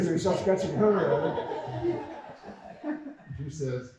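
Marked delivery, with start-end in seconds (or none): none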